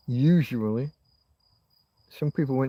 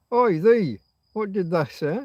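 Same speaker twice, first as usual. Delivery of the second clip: higher, louder Yorkshire oi; Yorkshire call-out; 5 semitones higher, 3 dB louder than usual. Shown as a demonstration. Showing how Yorkshire kids call out, loud Yorkshire oi. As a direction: call across like a rough Yorkshire lad. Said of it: "We have thee and thou and thy".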